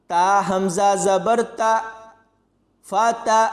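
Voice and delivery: ता cut short with a glottal jolt; letters spelt out, फा ता ending in a sharp catch; jerk on hamza. Shown as a demonstration. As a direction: stop ता abruptly on the hamza. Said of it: "यहाँ पर झटका देकर".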